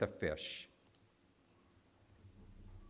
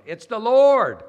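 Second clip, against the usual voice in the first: slow, much louder and higher cry; yelling out; 12 semitones higher, 17 dB louder, slower than usual. Retold or imitated. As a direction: shout the discovery across the water. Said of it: "he yells out".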